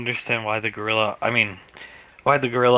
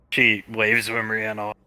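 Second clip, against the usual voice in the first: ordinary talk, nothing marked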